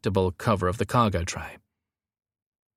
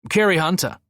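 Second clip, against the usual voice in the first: higher-pitched smooth answer; 9 semitones higher, 4 dB louder than usual. A narrator's reading. Gazza gives name to gate guard, smoothly. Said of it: "Gazza answered smoothly".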